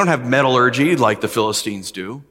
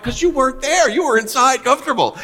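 teasing retort; 11 semitones higher than usual. Retold or imitated. Teasing retort to his wife.

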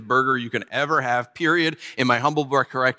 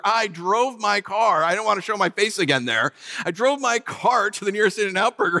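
ordinary delivery

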